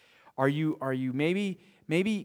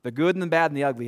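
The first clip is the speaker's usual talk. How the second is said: ordinary talk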